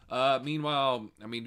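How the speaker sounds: usual speaking voice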